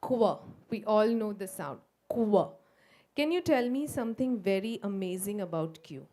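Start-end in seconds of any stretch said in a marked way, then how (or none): 0.0-2.5 loud "Q" as a hard "k"; "Q" said as the sound "k". Shown as a demonstration. Teacher saying the Q sound loudly. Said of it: "And the Q says".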